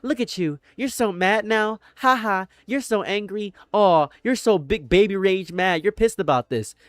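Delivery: high-pitched mocking gloat. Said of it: "people feel so happy with their hollow victories".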